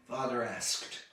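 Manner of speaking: ordinary delivery, nothing marked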